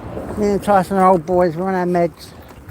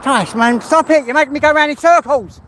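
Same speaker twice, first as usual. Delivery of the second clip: scolding the dog; 6 semitones higher, 3 dB louder than usual. Real, not acted. Telling off the dog.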